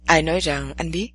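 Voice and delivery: low, sly and teasing; sly male voice. A narrator's reading. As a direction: answer with a cunning low tone. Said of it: "Chàng trả lời thật ma mảnh".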